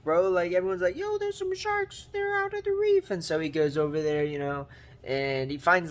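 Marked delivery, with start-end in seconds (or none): 0.9-3.1 high falsetto crowd cry; comic high-pitched warning call; mock alarm. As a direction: imitate a panicked onlooker in squeaky falsetto. Voices the crowd raising alarm.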